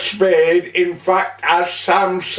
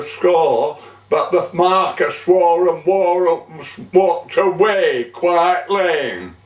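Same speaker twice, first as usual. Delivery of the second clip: ordinary delivery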